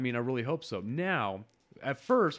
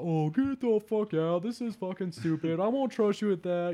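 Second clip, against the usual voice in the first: skeptic voice; 7 semitones higher than usual. Retold or imitated. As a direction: scoff like a jaded skeptic. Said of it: "that's your skeptic voice".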